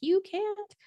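a cartoonish high ego voice saying no; mock ego voice. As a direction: squeak out the ego's put-down up high. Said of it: "your ego being like".

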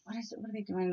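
murmured under the breath, curious; hushed listening. Retold or imitated. Mom overhearing kids fight, hushed and curious. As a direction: quietly wonder what the kids are up to. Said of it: "I'd listen and I'd be like".

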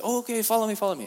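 high, loud beckoning; eager prisoner. Retold or imitated. Prisoners leading the visitor to the barber, high and eager. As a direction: call him along eagerly, high.

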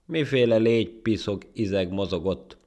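high, loud, lofty demand; haughty. Acted. Monkey spotting the ant below him, haughty. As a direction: sneer down at a nobody. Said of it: "mondta rátartian".

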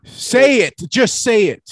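loud, high exasperated yell; impatient shout. Real, not acted. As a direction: shout at him to get to the point. Impatient friend demands the answer.

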